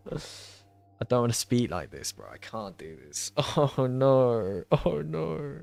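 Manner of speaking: put-on soft, slow, half-hearted British accent. Streamer out of practice gives up on a redeemed voice, feeble British accent. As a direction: let the mock British accent fade into quiet, laughing defeat. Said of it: "I can't do British accent anymore".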